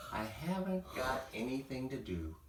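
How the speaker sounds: soft, forlorn little complaint. Acted. Young dragon sulking to his mother, forlorn and soft. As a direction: sigh it out, bored and lonely. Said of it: "in a forlorn voice".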